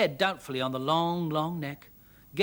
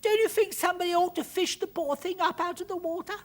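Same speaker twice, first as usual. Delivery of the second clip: put-on high, pleading little-girl voice; anxious child, voice pitched way up; urgent; 14 semitones higher, 5 dB louder than usual. A narrator's reading. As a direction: beg a grown-up to act. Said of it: "said Arabelle urgently".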